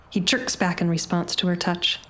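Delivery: ordinary delivery, nothing marked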